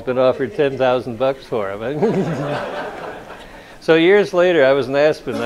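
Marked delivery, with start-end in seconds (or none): 1.9-3.6 laugh. Real, not acted. Author laughing at own story.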